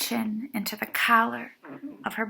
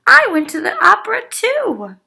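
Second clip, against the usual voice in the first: high, loud, fast eager boast; eager child; 12 semitones higher, 12 dB louder, faster than usual. A narrator's reading. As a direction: blurt it out, much higher and louder. Ruby eager to share.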